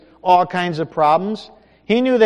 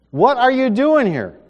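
higher, louder demanding question; loud rebuke; 9 semitones higher, 8 dB louder than usual. Acted. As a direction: demand it like thunder. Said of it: "in a gentle whisper".

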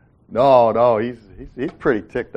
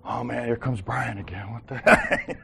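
grumbling; 4 dB quieter than usual. Retold or imitated. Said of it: "Not like".

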